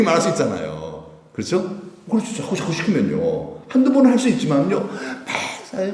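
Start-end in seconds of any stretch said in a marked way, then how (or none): none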